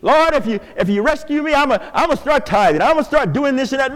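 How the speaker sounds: rushed, fast mock bargaining with God; bargaining prayer. Pastor mimicking people bargaining with God, fast mock plea. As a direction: rattle off a deal with God. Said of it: "Have you heard people talk like that?".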